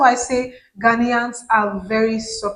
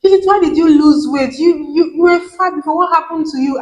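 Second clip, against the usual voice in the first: curious fan asking; 4 semitones higher, 4 dB louder than usual. Retold or imitated. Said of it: "Some of them would ask me".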